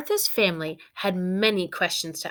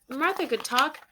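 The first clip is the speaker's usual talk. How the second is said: ordinary delivery, nothing marked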